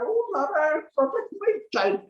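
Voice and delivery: high, pleading prayer; praying mother. Retold or imitated. Yashoda praying to Narayan for her child, pleading. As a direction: call up to Narayan, imploring. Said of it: "she's praying to Shri Narayan".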